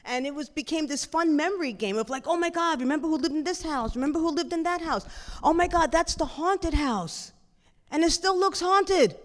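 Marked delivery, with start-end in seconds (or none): 2.3-9.2 higher, eager recognition; delighted mock amazement at familiar sights; excited reminiscing. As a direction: blurt out shared childhood memories. Siblings reminiscing over old houses.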